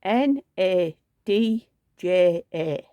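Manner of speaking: slow, separate letters N A D J A; spelled out letter by letter. Shown as a demonstration. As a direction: spell N A D J A slowly and clearly. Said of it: "You spell that".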